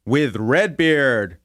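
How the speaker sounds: Redbeard stretched slow; drawn-out tag. Shown as a demonstration. Tagging the host's name on a radio ID, slow. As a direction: drag out With Redbeard slowly. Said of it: "do that again".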